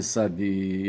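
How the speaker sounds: ordinary talk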